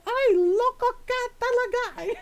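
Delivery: high, loud Tagalog yell. Retold or imitated. Mom yelling at her daughter in Tagalog, high and loud. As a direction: shout it at her in Tagalog, exasperated. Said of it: "I'm yelling in Tagalog".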